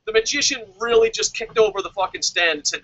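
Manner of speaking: normal talking voice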